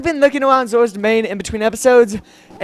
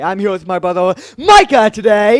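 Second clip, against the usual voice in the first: hype introduction; 8 dB louder than usual. Pretend. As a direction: shout the name like introducing a guest. Introduces his brother to viewers.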